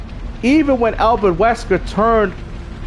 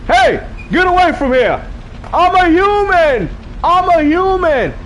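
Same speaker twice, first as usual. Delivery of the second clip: urgent shout; 9 semitones higher than usual. Retold or imitated. Streamer re-enacting Leon at the gun shop, urgent shouting. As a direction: play a panicked yell from a game scene. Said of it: "he's like".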